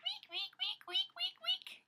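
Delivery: very high squeaky wheek calls; guinea pig voice. Shown as a demonstration. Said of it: "I like to make guinea pig sounds".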